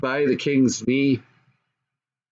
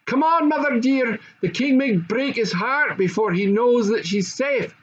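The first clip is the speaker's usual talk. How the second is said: high, urgent, calling while running; 8 semitones higher than usual. A narrator's reading. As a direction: hurry mother along.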